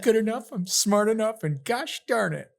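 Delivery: high-pitched, earnest self-affirmation impression; Stuart Smalley. Quoting a self-help character to tease a co-host, high put-on affirmation voice. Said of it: "Is it Stuart Smalley?".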